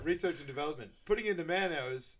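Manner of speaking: put-on presentation voice; imitated presenter. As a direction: announce each item like a showy presenter. Acting out a business presentation.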